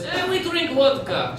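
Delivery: high, slow Ukrainian-accented impression; thick mock Ukrainian accent; strong Ukrainian accent. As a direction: mimic a Ukrainian woman, drawn out and high. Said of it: "She had a very strong accent".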